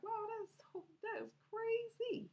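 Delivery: high, drawn-out reaction; retold amazement. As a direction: play back astonishment in a high, stretched voice.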